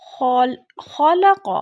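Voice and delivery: خلق with خ and ق said thick and heavy from the throat; heavy letters. Shown as a demonstration. Teacher shows the heavy letters in خلق, slow and emphatic. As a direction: give خلق a full, heavy خ and ق. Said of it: "خا کو بھی موٹا پڑھا اور قاف کو بھی".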